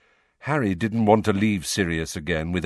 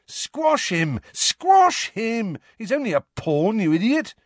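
high, excited, louder cheering; enthusiastic egging on; 14 semitones higher, 4 dB louder than usual. A narrator's reading. Harry cheers his castle on against Ron's pawn, excited. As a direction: urge the chess piece on. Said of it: "egging it on enthusiastically".

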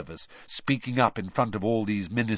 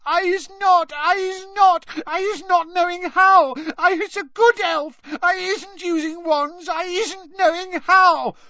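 loud, very high, tearful elf protest; terrified, squealing Winky; 9 dB louder than usual. A narrator's reading. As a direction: shriek frantic denials in a squeaky voice. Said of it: "her eyes rolling in terror".

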